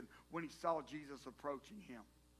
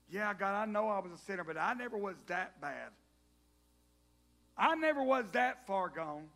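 louder, slowing on "far gone"; self-justifying whine; 3 semitones higher, 9 dB louder than usual. Acted. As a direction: justify yourself with mock pride. Mocking believers' self-justification.

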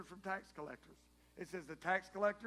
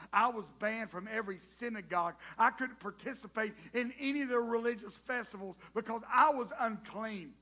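louder and higher, slowing on "I was unclean"; building to an outcry; 6 semitones higher, 11 dB louder than usual. Acted. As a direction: Matthew crying out who he really is. Matthew's confession builds to a cry.

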